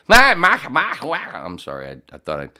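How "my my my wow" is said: put-on high, loud, botched Australian accent; failed Australian accent. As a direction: belt out a bad Australian accent. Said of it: "could do a Australian accent real quick".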